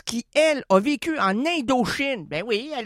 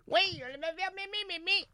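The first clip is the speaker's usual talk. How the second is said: put-on old granny voice; 8 semitones higher, 7 dB quieter than usual. Voicing the grandma.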